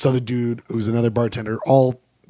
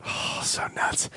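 whisper; 10 dB quieter, slower than usual. Real, not acted. Whispered aside.

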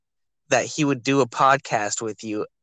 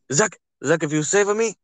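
higher-pitched, badly faked Russian accent; terrible Khabib accent; 4 semitones higher than usual. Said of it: "that's a terrible fucking Khabib accent".

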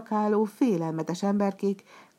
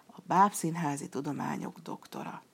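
usual speaking voice

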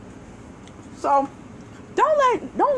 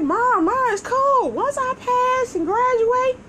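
put-on a kid calmly reassuring mom, pitched up; pleading to mom; 11 semitones higher than usual. Teen reassuring mom about teachers, lightly voiced high. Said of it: "I'm like".

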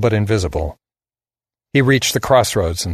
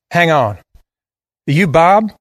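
put-on higher, louder southern boy; southern drawl; 7 semitones higher, 4 dB louder than usual. A narrator's reading. Boy halts the man about to fire, southern drawl. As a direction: stop him short in a Southern drawl. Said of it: "He had a deep southern drawl".

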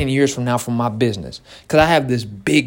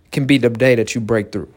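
ordinary delivery

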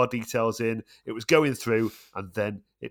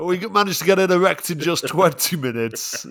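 high, loud salesy presenter voice; mock advert pitch; 9 semitones higher, 7 dB louder than usual. Joking tent review on the podcast, hammed-up advert voice. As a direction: boast like a cheesy ad.

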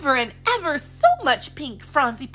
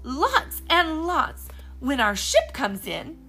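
slow, bright promise; cheerful promise; 6 semitones higher, 5 dB louder, slower than usual. Acted. Polly promising treats to Phronsie, slow. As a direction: stretch out the promise happily.

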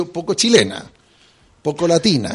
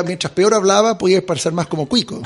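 ordinary delivery

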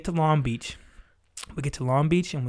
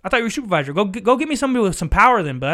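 higher, louder, fed-up retold rant; retold angry outburst; 6 semitones higher, 5 dB louder, faster than usual. To the supervisor at the gate, a fed-up retold rant. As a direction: throw it back at him, exasperated.

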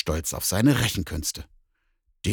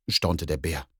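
normal talking voice

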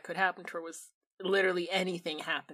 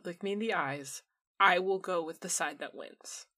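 normal talking voice

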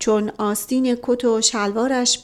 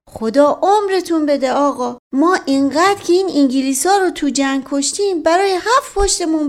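put-on higher, louder old servant rambling on; a servant boasting to the master, pitched up; Mash Qasem character voice; 7 semitones higher, 5 dB louder than usual. A narrator's reading. Servant boasting to his master, higher and louder.

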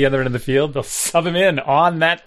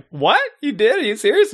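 shocked disbelief; 9 semitones higher than usual. Retold or imitated. His shocked reaction retold.

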